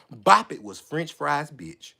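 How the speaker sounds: low, soft, slow statement; quiet, deliberate punchline; understated verdict. Retold or imitated. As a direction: drop the voice and draw it out. First take, lower and softer.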